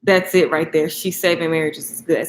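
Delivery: ordinary talk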